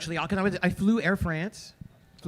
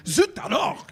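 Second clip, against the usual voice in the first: higher mock French exclamation; exclaimed French accent; 5 semitones higher than usual. Flight director reacting to trash, mock French exclamation. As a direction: imitate a Frenchman's indignant oath. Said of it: "flight director was like".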